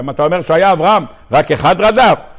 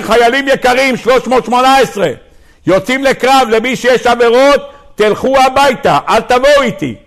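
lightly voiced commander, pitched higher; commander's address; 6 semitones higher than usual. Abraham to his soldiers before battle, a commander's address. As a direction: address the troops in a raised, carrying pitch.

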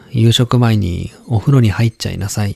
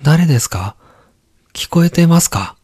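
put-on higher, eager child calling; young boy voice; 7 semitones higher, 3 dB louder than usual. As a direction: call out like an excited boy. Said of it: "男の子が".

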